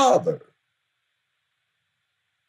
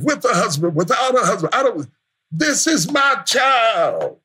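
full-force preaching; 6 semitones higher than usual. Acted. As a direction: drive it home from the pulpit. Preacher's climactic run.

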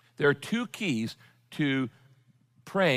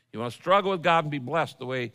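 ordinary talk

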